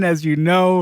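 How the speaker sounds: normal talking voice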